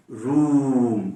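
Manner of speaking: रूम very slow, the oo drawn out; teacher's slow रूम; long vowel. Shown as a demonstration. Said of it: "फिर से लंबा sound".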